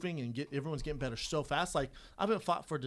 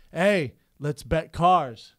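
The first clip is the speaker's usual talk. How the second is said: slow, much louder dare; cocky challenge; 4 semitones higher, 12 dB louder, much slower than usual. Retold or imitated. Opponent at the car window daring a bet, slow loud swagger.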